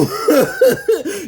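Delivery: a loud, high cackle at her own point; loud high laugh. Real, not acted. Laughing at the grandpa's karma, loud and high.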